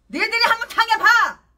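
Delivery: preacher screams in rage at his enemies; furious shout. Real, not acted. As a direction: scream the challenge at them.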